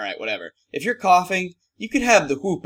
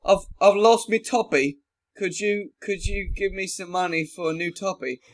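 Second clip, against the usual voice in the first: put-on Cockney accent; 6 semitones higher than usual. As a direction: beg for coins in a Cockney accent. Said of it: "Only if you throw in a Cockney accent".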